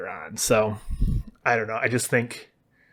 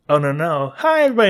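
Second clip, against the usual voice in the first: a sudden bright, much higher and louder "Hi, everybody" like going live; streamer voice; 6 semitones higher, 6 dB louder than usual. Retold or imitated. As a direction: snap into a cheery stream-host greeting. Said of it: "puts on the, the Tatara Channel voice".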